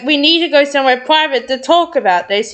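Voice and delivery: plain reading voice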